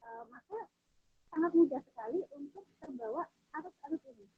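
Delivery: very faint, far from the mic; barely audible. Real, not acted. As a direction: voice drifting away from the mic. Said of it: "suaranya terlalu kecil".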